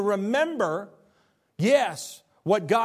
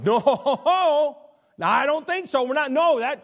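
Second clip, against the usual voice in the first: emphatic protest; 7 semitones higher, 4 dB louder than usual. Acted. Peter refusing to accept Jesus' death, protesting loudly. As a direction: object loudly in a raised, hammed-up voice.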